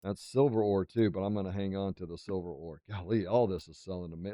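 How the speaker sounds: ordinary talk, nothing marked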